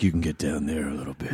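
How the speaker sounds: low hushed demo of a husky register; dipping into husk. Shown as a demonstration. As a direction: drop right down low and quiet, into the husk. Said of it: "dip down into the husk".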